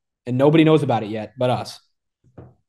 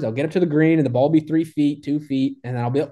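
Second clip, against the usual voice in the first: usual speaking voice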